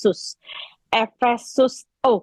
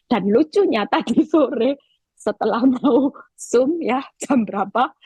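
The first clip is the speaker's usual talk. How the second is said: ordinary talk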